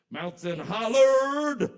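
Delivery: a sudden huge high shout, drawn out slowly; hollered. Acted. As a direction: holler it out like calling across mountains. Poem climax, word hollered out.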